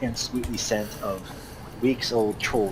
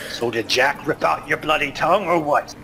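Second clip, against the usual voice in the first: loud, higher mocking dwarf; dwarf jeering at a silent companion; gruff taunt; 7 semitones higher, 5 dB louder than usual. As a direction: needle him for keeping silent. Dwarf voice held.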